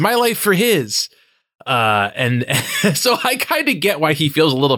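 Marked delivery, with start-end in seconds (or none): 0.0-1.1 high ringing invocation; solemn plea. Retold or imitated. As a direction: cry it out to the heavens. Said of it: "He invokes the new sun and says".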